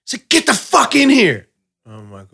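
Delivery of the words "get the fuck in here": high-pitched furious demand; mock enraged confrontation; imitated angry partner. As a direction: act out her scolding outburst. Voices angry partner ordering him.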